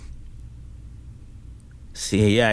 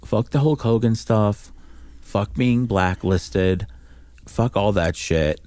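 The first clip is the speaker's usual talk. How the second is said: each word dropped slow and heavy; slow and emphatic; 3 semitones lower, slower than usual. Real, not acted. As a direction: lay it down, word by word. Pleading partner to drop feud.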